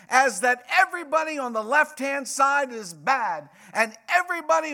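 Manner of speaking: high, loud pulpit proclamation; full-force preaching. Real, not acted. Preacher warning congregation against misreading scripture, full-force preaching.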